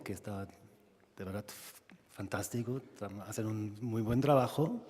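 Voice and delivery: ordinary talk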